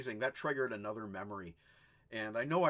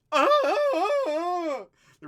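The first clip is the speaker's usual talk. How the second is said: hammed-up crying, much higher, louder and slow; mock wail; 15 dB louder than usual. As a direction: wail it like a fake sob. Sudden wailing aside.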